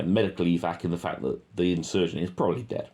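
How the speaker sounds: usual speaking voice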